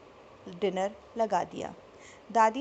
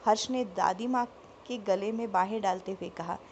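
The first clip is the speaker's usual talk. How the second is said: usual speaking voice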